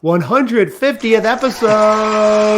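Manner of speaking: slow, grand hype on the end; announcer voice. Acted. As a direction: build up like a game announcer. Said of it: "I'm using my announcer voice".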